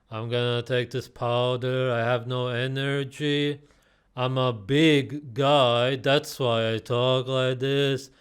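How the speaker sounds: mock a sluggish bodybuilder, stretching words into a loud sing-song; dopey slow-talker parody, increasingly drawn out. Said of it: "He's also so slow".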